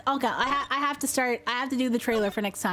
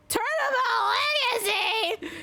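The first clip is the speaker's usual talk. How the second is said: high, thrilled exclamation; excited title announcement; 11 semitones higher than usual. Real, not acted. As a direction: cry out the title, pitched way up. Shouts out case title excitedly.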